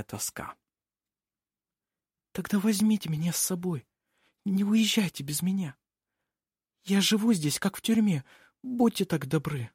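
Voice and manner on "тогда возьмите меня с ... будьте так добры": put-on high, soft girlish plea; pleading young girl. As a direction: beg gently in a high young voice. Nikola begs to be taken away.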